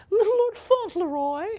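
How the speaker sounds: very high, loud mock falsetto; hammed-up falsetto. Auctioneer playfully announcing an old film title, hammed-up falsetto. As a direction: squeal the film title in a silly put-on voice.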